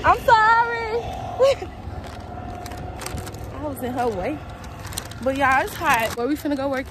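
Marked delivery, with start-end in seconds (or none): 0.0-1.0 much higher, louder quick-reaction apology. Real, not acted. Apologising for being in someone's way, high and loud. 1.0-3.5 high, breath-filled laugh; high embarrassed laugh. Real, not acted. Laughing after getting in someone's way, high and airy.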